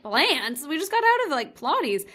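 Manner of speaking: reacting to a lame excuse, incredulous. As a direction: question the excuse like it's absurd. Disbelief at selling plants excuse.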